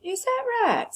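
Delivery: high, amused surprise; waitress marvels at the boy's claim; surprised waitress. Acted. Waitress reacts to Bryce.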